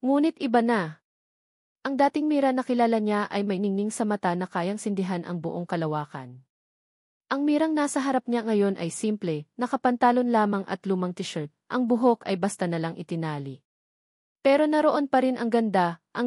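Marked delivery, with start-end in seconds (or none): none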